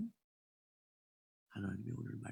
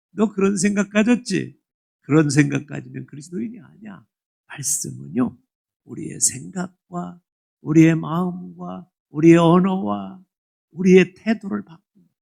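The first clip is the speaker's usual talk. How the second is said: loud, driving sermon voice; fervent preaching; 3 semitones higher, 19 dB louder than usual. Acted. Pastor preaching loudly to the congregation, fervent. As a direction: press the point home to the congregation.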